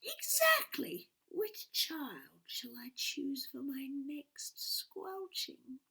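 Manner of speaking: a witch choosing her next victim, whispering; witch whispering to herself. A narrator's reading. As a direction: savour each word like a secret plan. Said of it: "she says to herself".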